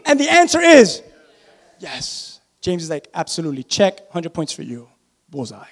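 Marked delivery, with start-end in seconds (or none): 1.8-5.7 much lower and softer; low, quiet, deadpan approval; hushed confirmation. Acted. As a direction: drop right down for an understated yes. James's answer, suddenly low and hushed.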